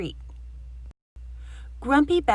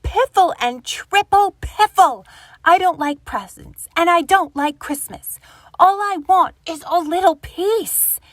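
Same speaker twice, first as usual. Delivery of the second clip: higher and much louder indignant rant; snorting grumpy Badger; 7 semitones higher, 9 dB louder than usual. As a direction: slam the door on the world with your voice. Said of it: "snorted Grumpy Badger".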